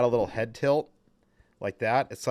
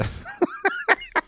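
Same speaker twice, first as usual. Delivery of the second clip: burst of laughter. Real, not acted. Laughing at the puppet's mouth.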